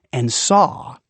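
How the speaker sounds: ordinary delivery, nothing marked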